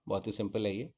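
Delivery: normal talking voice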